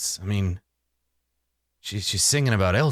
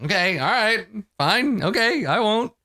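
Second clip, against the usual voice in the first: high grudging woman; 9 semitones higher than usual. Acted. GM voices Tarina, giving in.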